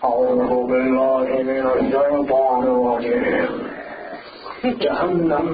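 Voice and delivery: low, fast monotone droning recitation; mock stern Maulana. Humourless Maulana who only preaches about Jahannam, solemn mock intoning. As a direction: drone it like an unsmiling hellfire cleric. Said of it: "when he talks, he talks like this".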